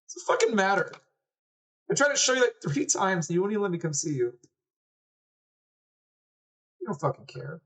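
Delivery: put-on high-pitched, hurt boy's voice; upset little brother. Little brother tells sister she ignored him, hurt and angry. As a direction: accuse your sister in a high voice.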